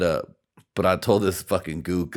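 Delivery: normal talking voice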